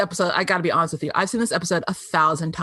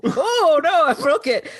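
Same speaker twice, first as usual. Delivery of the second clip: high, hammed-up lament; mock dismay; 7 semitones higher, slower than usual. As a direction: wail it comically, imitating his frustration. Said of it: "he gets, like, a little frustrated".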